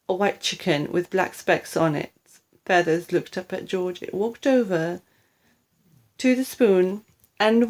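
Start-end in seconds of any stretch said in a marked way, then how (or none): none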